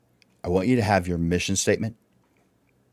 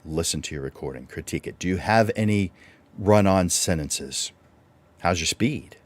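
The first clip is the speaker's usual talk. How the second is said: usual speaking voice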